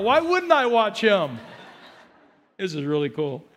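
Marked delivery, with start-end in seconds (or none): none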